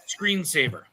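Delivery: ordinary talk, nothing marked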